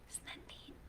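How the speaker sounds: soft whisper; whispered aside. Real, not acted. Teacher whispering to the kids about the pictures. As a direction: breathe it in awe.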